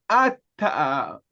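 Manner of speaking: ordinary talk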